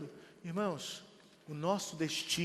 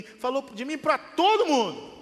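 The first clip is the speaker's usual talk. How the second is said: rising indignation; 8 semitones higher, 9 dB louder than usual. Retold or imitated. Building indignant retelling.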